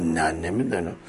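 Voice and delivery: low, soft acted reply; quiet, low-pitched concession; subdued admission. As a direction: drop right down to admit it. Harun's acted answer to the riddle.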